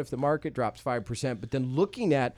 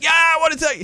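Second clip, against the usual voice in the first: much higher, louder mock bravado; bold eager shout; 11 dB louder, faster than usual. Bragging to the sleepy town, high and loud. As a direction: blurt it out full of youthful swagger. Young self's bold answer.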